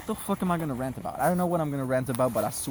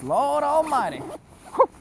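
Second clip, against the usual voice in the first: straining exclamation; 13 semitones higher, slower than usual. Real, not acted. Straining to pull coat on.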